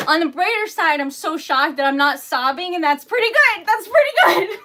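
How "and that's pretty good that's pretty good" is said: high, loud, giddy relief; excited. Real, not acted. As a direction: laugh it off, pleased. Relieved joking.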